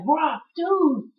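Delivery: high, whiny mock plea; mock pleading. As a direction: beg the Rock like a heartbroken fan. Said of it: "I was like".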